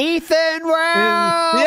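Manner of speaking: drawn-out high hype intro; hype announcer. Pretend. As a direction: announce him like a ring entrance.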